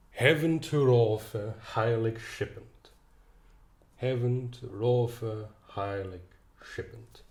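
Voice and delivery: put-on slow, deliberate recitation of Old English lines; reading verse aloud. Shown as a demonstration. Teacher reciting Old English verse for students to find stresses. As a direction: read each half line slowly so the stresses stand out. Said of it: "I will read it out".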